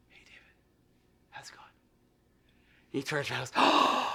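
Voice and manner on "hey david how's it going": soft sneaky whisper right into his ear. Retold or imitated. Sneaking up on his friend mid-class, whispering in his ear. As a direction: whisper it close so only he hears.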